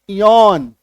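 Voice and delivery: "इयॉन" with ee gliding into open o; two-vowel demonstration. Shown as a demonstration. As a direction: model "इयॉन" firmly, both vowels heard. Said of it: "ई ओ". ("इयॉन" में ई और ऑ दोनों)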